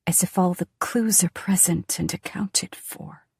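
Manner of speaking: usual speaking voice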